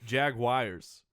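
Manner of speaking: "Jaguars" as JAG-wires; deliberate mispronunciation. Shown as a demonstration. Co-host insisting on own local version, deliberate mispronunciation. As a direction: slowly teach "Jaguars" with 'wires' at the end. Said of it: "it's pronounced".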